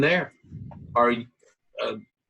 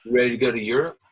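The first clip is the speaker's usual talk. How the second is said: usual speaking voice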